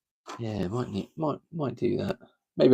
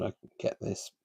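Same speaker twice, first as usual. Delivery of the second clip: breath held, barely voiced; holding breath; 10 dB quieter than usual. Real, not acted. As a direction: squeeze the words out while holding breath. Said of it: "doing the old holding my breath".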